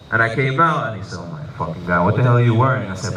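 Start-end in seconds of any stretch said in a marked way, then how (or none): 1.2-2.9 shocked dad. Retold or imitated.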